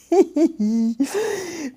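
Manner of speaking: high, loud laugh that tips toward a cry; cracking up at the memory; nervous burst of laughter. Real, not acted. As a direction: laugh it off, half crying. Nervous laugh recalling customs stress.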